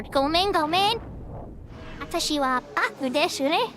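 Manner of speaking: cute rising Japanese apology; Japanese phrases. Shown as a demonstration. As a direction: say gomen gomen with a lifted, playful lilt.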